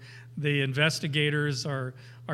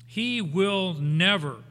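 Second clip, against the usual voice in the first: slow stressed "he will never"; solemn promise; emphatic; slower than usual. Shown as a demonstration. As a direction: press "he will never" down, slowly.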